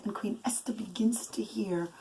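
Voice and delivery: soft hushed whisper; suspenseful whisper. A narrator's reading. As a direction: draw the children in with a hush. Storyteller builds suspense.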